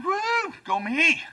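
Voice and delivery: high, whooping little celebration; pleased self-congratulating squeal; mock cheer. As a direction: give yourself a silly victory whoop. Comic acts out his own cheer.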